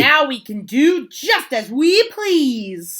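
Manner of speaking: put-on high, loud gleeful kids. Class gloating about getting rid of the principal, loud and high. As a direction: crow it like kids set free. Class cheering in read-aloud story.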